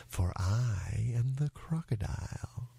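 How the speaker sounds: slow, whispery crocodile. Acted. Crocodile reveals himself to the child, slow and whispery. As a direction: draw it out in a sly half-whisper.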